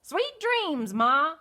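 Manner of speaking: put-on high, loud southern baby goose. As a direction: holler howdy like a little cowboy goose. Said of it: "said the baby goose".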